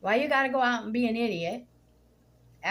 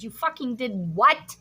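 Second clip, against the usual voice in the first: angry retort; 3 semitones higher, 6 dB louder than usual. Retold or imitated. Retold fight with neighbor.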